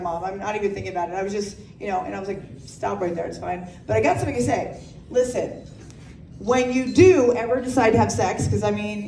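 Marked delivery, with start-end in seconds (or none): 0.0-3.7 hushed embarrassed. Retold or imitated.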